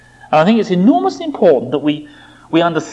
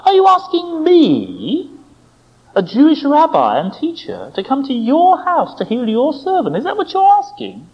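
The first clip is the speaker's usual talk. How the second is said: teasing question; 10 semitones higher than usual. Retold or imitated. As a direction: tease with swooping, much higher questions. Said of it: "It's a teasing question".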